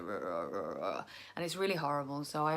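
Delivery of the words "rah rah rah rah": low, rough "Rah, rah, rah, rah"; croaky rasp demo. Shown as a demonstration. Demonstrating her croaky tired voice to viewers, low rasp. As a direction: show how your tired voice croaks, much lower. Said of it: "my voice just gets a little bit like".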